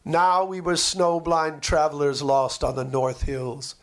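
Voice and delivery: ordinary delivery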